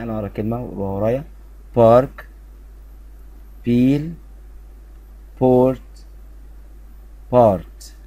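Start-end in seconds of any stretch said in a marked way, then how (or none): 1.8-8.0 each word called out much louder, with pauses to repeat; loud drill. Shown as a demonstration. Teacher calls out words for pupils to repeat, loud. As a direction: lead the choral drill, loud and clear. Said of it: "اقروا ورايا".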